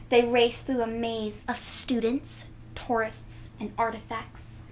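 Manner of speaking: plain reading voice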